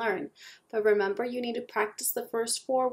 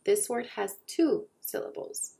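ordinary talk

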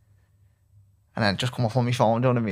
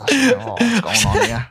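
high, slow, hammed-up confusion; mock bewilderment; 11 semitones higher, 6 dB louder, slower than usual. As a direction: play dumb, drawn out and higher. Mock bewilderment at Gerrard leaving.

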